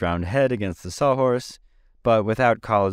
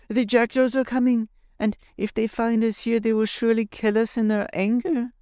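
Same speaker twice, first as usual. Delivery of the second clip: put-on much higher, alarmed boy's cry; exclaiming. A narrator's reading. Tip warning the others, alarmed high cry. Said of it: "Tip exclaimed".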